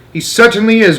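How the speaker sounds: put-on high, loud boy shout; boy shouting from inside the handbag. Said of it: "Bruno shouted".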